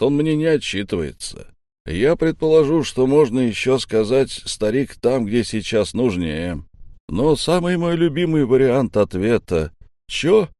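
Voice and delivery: high, animated mock-helpful listing; cheeky answering back. Insolent trooper answering the first mate, cheeky and animated. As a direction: reel off options, pitch leaping on the punchline. Rebel mockingly lists possible answers.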